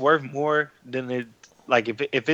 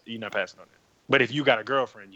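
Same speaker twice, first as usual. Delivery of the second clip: ordinary talk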